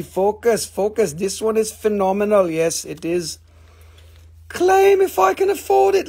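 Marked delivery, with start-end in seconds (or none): 4.5-6.1 reading a viewer comment out in a high, loud mock scream. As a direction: squeal the comment like an overexcited buyer. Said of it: "Look, she's screaming".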